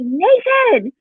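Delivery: sharp high call of a name; sudden high yell. Retold or imitated. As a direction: yell a kid's name across the room. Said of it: "I went to yell at someone".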